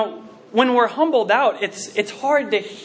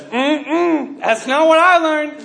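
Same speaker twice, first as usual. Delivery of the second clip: stubborn denial; 7 semitones higher, 4 dB louder, much slower than usual. Retold or imitated. Retells student arguing back.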